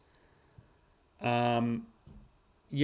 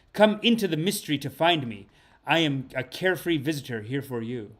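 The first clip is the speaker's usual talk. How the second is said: intoning the card's words to the viewers; solemn card reading; 2 semitones higher, 4 dB louder than usual. Acted. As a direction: read it like a sacred message from beyond. Reads the oracle card aloud.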